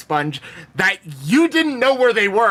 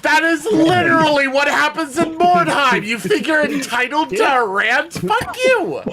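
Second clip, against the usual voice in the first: raised, high-pitched outrage; indignant rant; 7 semitones higher than usual. Acted. Player railing at the entitled thief, indignant rant. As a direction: climb to an incredulous curse.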